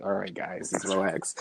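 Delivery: calming. Retold or imitated. Sober friend calming them down.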